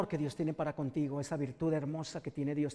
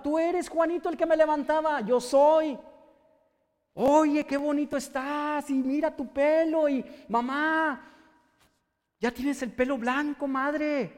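slow, higher, moved voice greeting family; tender joyful reunion; 8 semitones higher, 6 dB louder, slower than usual. Acted. Healed son sees his brother and mother, moved joy. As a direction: greet your family for the first time in wonder.

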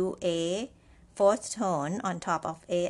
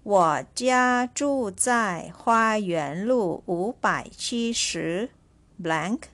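slow dictation; 3 dB louder, slower than usual. Shown as a demonstration. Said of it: "Again, I repeat".

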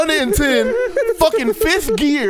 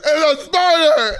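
1 mock scream; 7 semitones higher, 4 dB louder, much slower than usual. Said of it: "all you hear is".

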